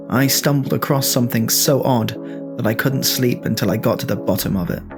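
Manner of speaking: ordinary talk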